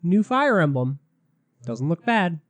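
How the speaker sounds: ordinary talk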